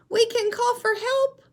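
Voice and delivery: very high, loud penguin voice; a chick comforting the seal with an idea; Little Penguin voice. Shown as a demonstration. Teacher voicing Little Penguin reassuring Baby Seal. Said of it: "show you how to use voices".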